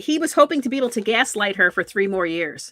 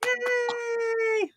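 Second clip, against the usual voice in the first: cheering; 12 semitones higher, 6 dB quieter than usual. Real, not acted. Cheering the green card.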